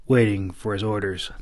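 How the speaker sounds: ordinary delivery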